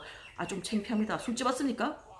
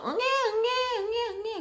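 much higher mock baby wail; baby crying. Teller imitating a crying baby on the flight, high mock wail. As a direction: imitate a newborn crying on the plane. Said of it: "자기 애가 막 울었어".

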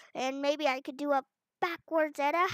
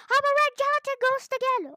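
higher, louder squealing repeat; dismayed squeal; 11 semitones higher, 8 dB louder than usual. Real, not acted. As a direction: yelp it out in dismay. Turned into ghost, said twice.

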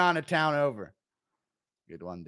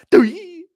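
high, loud cartoon yelp; the sound of the ball smacking a kid; mock cry of a kid hit by a ball; 11 dB louder than usual. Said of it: "He just goes in there".

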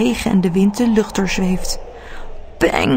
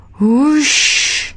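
louder hoesh; balloon whoosh; 5 semitones higher, 4 dB louder than usual. A narrator's reading. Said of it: "doet de luchtballon".

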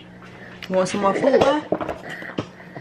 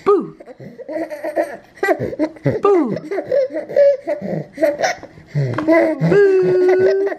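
put-on high playful boo for the baby; playful peekaboo; 8 semitones higher, much slower than usual. Mother playing peekaboo with her baby, high and playful. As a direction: pop out the boo to make the baby laugh.

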